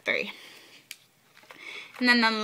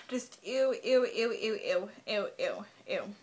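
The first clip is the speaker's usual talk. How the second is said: disgusted; 5 dB quieter, slower than usual. Real, not acted. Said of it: "The only card I really don't like is red".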